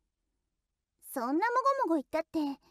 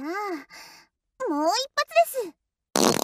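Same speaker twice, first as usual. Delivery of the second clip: gleeful threat, rising and louder; playful emphasis; 3 semitones higher, 5 dB louder, faster than usual. Acted. Teasing threat of another one. (いたずらっぽく声を張って)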